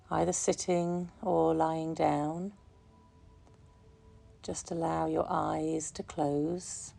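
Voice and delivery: calm, unhurried meditation voice, softening gradually; guided meditation. Real, not acted. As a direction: guide listeners gently into relaxation, leaving long pauses. Leads a relaxation meditation.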